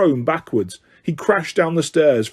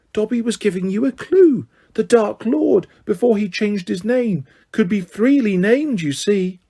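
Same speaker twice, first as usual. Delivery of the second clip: high, earnest elf explaining; as though it was obvious; high elf voice, eager; 7 semitones higher than usual. Acted.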